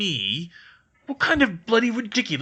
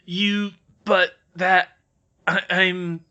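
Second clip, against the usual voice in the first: put-on slow, flustered higher old man; louder, halting sputter; stammering; 6 semitones higher, 8 dB louder, much slower than usual. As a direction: stumble over the words in shock. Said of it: "Filch stammered".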